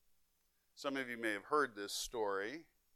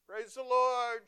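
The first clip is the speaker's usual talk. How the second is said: high, loud and slow cry of praise; cried out; 10 semitones higher, 7 dB louder, slower than usual. Retold or imitated. Old lady finding the groceries, high slow loud cry. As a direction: stretch it out in loud delight. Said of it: "she cried out".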